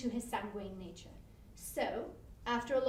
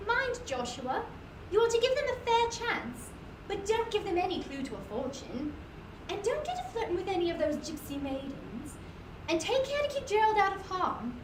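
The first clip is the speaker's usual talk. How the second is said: raised, higher calling voice; calling after husband; 5 semitones higher, 4 dB louder than usual. A narrator's reading. Wife calling after her husband at the gate. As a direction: call it out down the lane.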